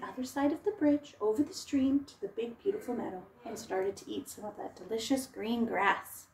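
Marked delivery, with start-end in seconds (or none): none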